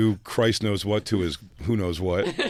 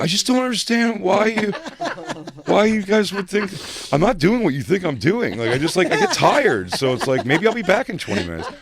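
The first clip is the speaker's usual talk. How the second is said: much higher, louder mock-defensive whine; whiny teen Max; 11 semitones higher, 4 dB louder than usual. Mimicking the boy insisting he's only tired. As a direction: protest high and whiny, laughing through it. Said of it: "Max is like".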